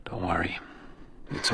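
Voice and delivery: soft soothing whisper. Acted. Becker whispering comfort to frightened Fiona. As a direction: hush her gently.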